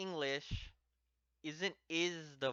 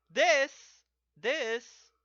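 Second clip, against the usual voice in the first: "This, this" much higher and louder, pointing; pointing emphasis; 9 semitones higher, 10 dB louder than usual. Shown as a demonstration. Teacher shows that kore wa means pointing at this, emphatic. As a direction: jab "This, this" loud and high as if pointing. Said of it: "like that".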